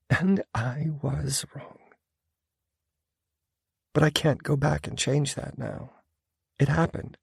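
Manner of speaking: resigned and heavy; bitter woman. A narrator's reading. Female character, bitter.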